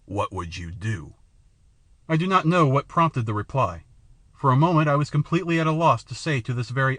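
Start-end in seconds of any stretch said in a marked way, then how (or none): 0.1-1.1 a haughty king, low and slow; haughty frown. A narrator's reading. The king demands an answer from the stranger, haughty and slow. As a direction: press the question down at him, slow and lordly. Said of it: "with a haughty frown".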